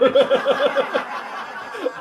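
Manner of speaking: high laugh; a real laugh at himself. Real, not acted. Comic cracking up over his wrecked car, laughing.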